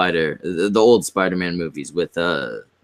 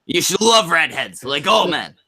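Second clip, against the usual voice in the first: mock chat voice; 6 semitones higher than usual. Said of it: "the bitching witch says".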